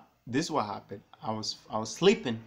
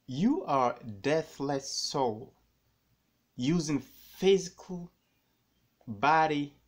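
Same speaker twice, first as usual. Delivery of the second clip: slow, drawn-out words pitched higher; emphatic; 4 semitones higher, 3 dB louder, much slower than usual. Real, not acted. Telling viewers who they really are, slow and emphatic. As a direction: lay each word down slowly for weight. Preaching to viewers about the soul.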